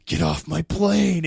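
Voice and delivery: hammed-up movie-hero delivery; quoting the famous line with a bit of grit; Harrison Ford line. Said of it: "and he goes".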